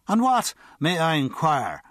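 mock-grand drunk probing; drunken Irishman. A narrator's reading. Drunk questions the guard, pompous and tipsy. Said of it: "said the drunk".

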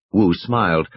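usual speaking voice

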